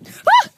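mock sinking scream, very loud and high; sharp high scream. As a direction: let out a sudden scream.

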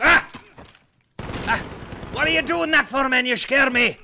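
high panicked shout; startled yell. Real, not acted. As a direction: yell out in fright. Startled by sudden enemy.

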